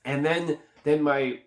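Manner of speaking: ordinary talk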